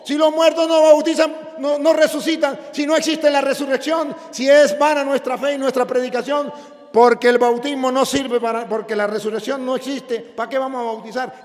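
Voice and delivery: loud, high pulpit shouting; impassioned sermon shout; fiery preaching. Acted. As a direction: preach it with full force to the crowd. Preaching at full force.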